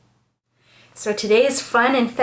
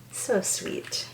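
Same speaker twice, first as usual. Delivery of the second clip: breathy whisper of delight; hushed little aside; 11 dB quieter than usual. Real, not acted. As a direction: whisper it to yourself. Soft aside while reading.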